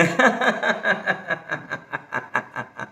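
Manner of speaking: forced staccato laugh, fading out; deliberate laugh. Shown as a demonstration. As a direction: laugh on purpose until the breath runs out. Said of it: "हसू येत नसेल, मुद्दामून". (मुद्दाम केलेलं लांब हास्य)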